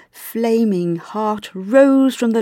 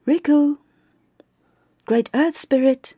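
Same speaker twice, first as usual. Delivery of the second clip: calling out; 6 semitones higher than usual. A narrator's reading. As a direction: call out to find someone. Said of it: "Oki called out".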